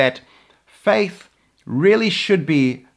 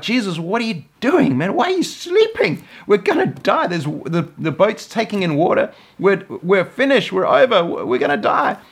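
high, frantic cries of fear; panicked disciples; 6 semitones higher than usual. Acted. Disciples waking sleeping Jesus in the storm, frantic high panic. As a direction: shout it like the boat is sinking.

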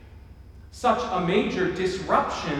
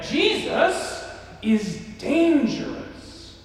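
slow, higher raspy proclamation; smoker's rasp; 8 semitones higher, much slower than usual. Retold or imitated. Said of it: "So she would say it again".

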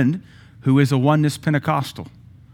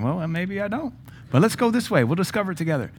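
mock high unsure voice, much higher; unsure leader; 7 semitones higher than usual. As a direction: play a leader shrugging it off, voice up high. Imitating someone unsure in healing line.

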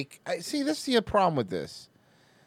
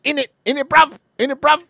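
hammed-up British slang, loud and high; loud, punchy fake London accent; mock British accent; 8 semitones higher, 10 dB louder than usual. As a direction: bark it out like a cartoon Brit. Mock British accent word game.

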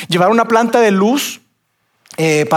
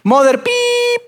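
distant shouting man; 6 semitones higher, slower than usual. Retold or imitated. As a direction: heckle them from across the field. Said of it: "se escucha la voz de una persona y dice".

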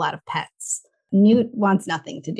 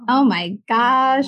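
slow, drawn-out gush; overwhelmed by cuteness; 5 semitones higher, 4 dB louder, slower than usual. Real, not acted. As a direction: melt over the bunny. Cooing over a sleepy bunny.

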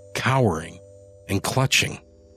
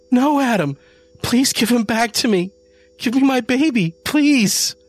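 much higher, louder frantic begging; desperate pleading; 5 dB louder than usual. Acted. Janie begging Adam for the cat, desperate high plea. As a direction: beg as if your child is taken.

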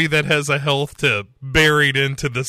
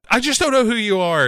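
high, fast, louder hammed-up plea; mock distressed cry; 10 semitones higher, 5 dB louder, faster than usual. [name] confronting [name], in a hammed-up distressed cry. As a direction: blurt it out near tears.

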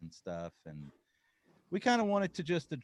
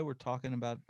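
ordinary talk, nothing marked

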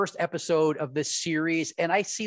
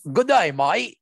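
mock Australian attempt; 5 semitones higher, 7 dB louder, slower than usual. Shown as a demonstration.

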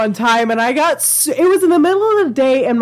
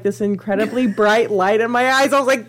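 ordinary talk, nothing marked

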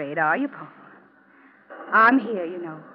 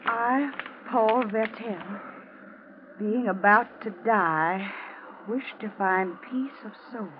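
solemn reading. Acted. Reading a written confession aloud.

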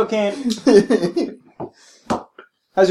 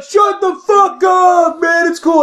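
high, drawn-out mock yell; stretched high shout; exasperated shout; 11 semitones higher, much slower than usual. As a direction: imitate a fed-up heckler hollering. Acted exasperated audience shouting.

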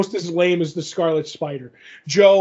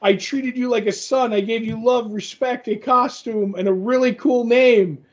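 higher, mock-hurt villain whine; wounded villain; 5 semitones higher than usual. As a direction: play the betrayed mentor, hammed-up. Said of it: "So he's like".

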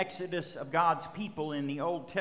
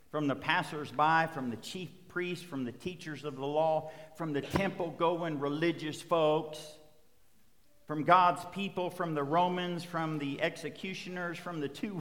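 ordinary talk

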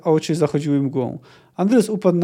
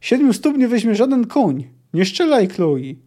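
raised, confident battle shout; higher exultant calling; triumphant yell; 6 semitones higher than usual. A narrator's reading. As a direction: call out sure of the catch. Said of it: "wrzasnął triumfalnie Mun".

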